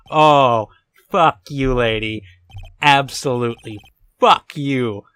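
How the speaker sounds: mad. Real, not acted. Gamer cursing the smug witness, genuinely furious. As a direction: spit it at her in real anger. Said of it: "I'm mad".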